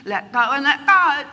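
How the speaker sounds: loud, high mocking slogan voice. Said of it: "people are saying".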